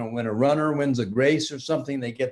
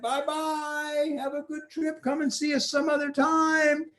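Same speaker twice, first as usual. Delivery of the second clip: waving goodbye; 12 semitones higher than usual. Acted. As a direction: call them off sweetly. King and soldiers wave off.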